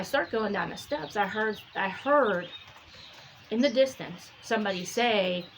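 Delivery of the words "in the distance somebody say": ordinary delivery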